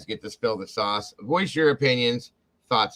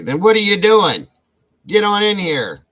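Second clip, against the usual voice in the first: playful call-out; 11 semitones higher, 9 dB louder than usual. Real, not acted. Teasing a viewer who skipped work.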